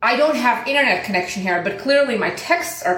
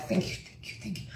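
tiny hushed, repeated thank-yous; whispered thanks; 3 semitones lower, 15 dB quieter, faster than usual. Retold or imitated. Customer told she can drive home, relieved whispered thanks. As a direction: whisper it over and over in relief, retold.

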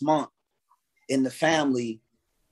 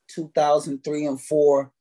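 ordinary talk, nothing marked

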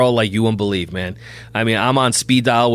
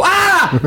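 sudden high-pitched yell, re-enacted; 3 dB louder than usual. Father testing his baby's hearing, re-enacted high yell. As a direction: imitate yelling into an ear. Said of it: "I yelled in her ear".

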